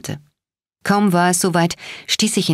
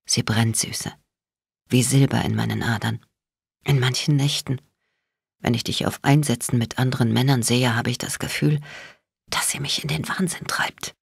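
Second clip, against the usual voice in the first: soft, lowered voice with menace; gently threatening; 3 semitones lower, 4 dB quieter than usual. A narrator's reading. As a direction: confess it gently, danger underneath. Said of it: "in demselben sanften, drohenden Tonfall fort".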